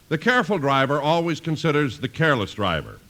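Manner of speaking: ordinary talk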